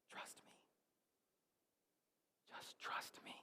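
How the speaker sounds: whispered, as God speaking softly; hushed whisper. Acted. Preacher voicing God to a lost listener, whispering. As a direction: breathe it, barely voiced.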